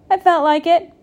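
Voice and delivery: loud, high, unrepentant; defiant retort. A narrator's reading. Goat defiant to Pig.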